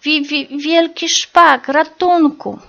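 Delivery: mother bird crying out at the sight of the starling; terrified cry for help. A narrator's reading. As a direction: stutter out the alarm in panic. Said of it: "Przerażona wołała".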